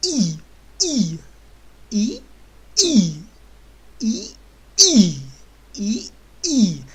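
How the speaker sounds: throaty ई pushed with force; German I from the throat. Shown as a demonstration. Teacher demonstrating throaty German ई. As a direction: say ई from the throat, with push. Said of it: "ज़रा हलक से आवाज़ निकलती है, थोड़ा ज़ोर लगा के".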